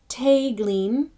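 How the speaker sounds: Tegleen, first part TAY like May. Shown as a demonstration. Teacher sounding out Tegleen with a May vowel. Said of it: "it's ing".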